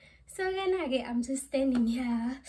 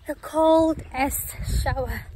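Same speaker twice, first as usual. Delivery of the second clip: emphatic; 3 semitones higher, 8 dB louder than usual. Real, not acted. Emphatic about cold shower.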